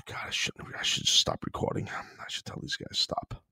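breathy whisper under his breath, talking to himself; whispered aside. Real, not acted. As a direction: whisper it to yourself, as if the mic shouldn't catch it. Muttering to himself mid-recording.

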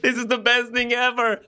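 high, bright burst of joy, mock-naive; thrilled. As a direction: gush like a kid who thinks the crowd is his.

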